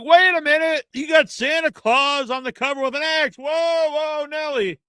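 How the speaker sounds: mock shocked.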